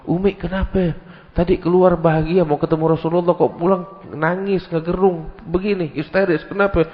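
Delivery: high, anxious pleading to their mother; worried children. Acted. Children asking their weeping mother what happened, high and worried. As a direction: sound like kids alarmed at mom's sobbing.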